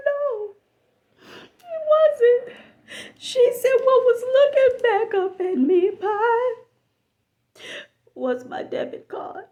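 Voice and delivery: hammed-up sobbing reveal; mock crying. Friend revealing where the card was, mock crying.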